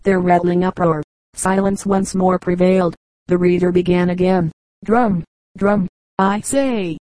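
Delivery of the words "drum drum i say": understated thundering order, pitch swooping on each cry; mock thundering command. A narrator's reading. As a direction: command the drummers without really shouting. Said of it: "thundered Wadsworth".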